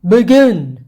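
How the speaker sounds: a loud, curt order to begin; commanding. A narrator's reading. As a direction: bark the command like a king. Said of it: "he commanded".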